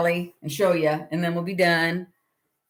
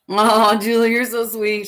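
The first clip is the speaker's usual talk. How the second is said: touched; 4 semitones higher, 6 dB louder than usual. Real, not acted. Touched by viewer comment.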